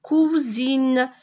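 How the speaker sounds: "cousine" stretched, with a long "ou" vowel; drawn-out "ou". Shown as a demonstration. Teacher warning it is not cousine, drawn-out "ou". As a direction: hold the "ou" in "cousine" for contrast. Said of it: "Ce n'est pas une cousine".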